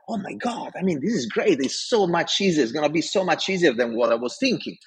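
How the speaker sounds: lifted, eager retelling of praise; excited scientists. Retold or imitated. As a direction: imitate delighted colleagues gushing. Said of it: "they're like".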